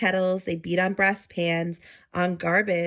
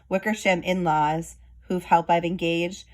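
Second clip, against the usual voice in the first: usual speaking voice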